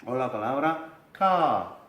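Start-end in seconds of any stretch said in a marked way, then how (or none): 1.1-1.8 'ka' with the R dropped and a long A; silent R. Shown as a demonstration. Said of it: "la R desaparece".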